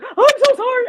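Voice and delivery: high, loud mock-wailed apology; acted high-pitched sorry; imitating upset child. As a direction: wail it like a little kid. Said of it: "and he's like".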